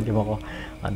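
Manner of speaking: chuckling through the words; soft laughing aside. Shown as a demonstration. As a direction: laugh it off softly.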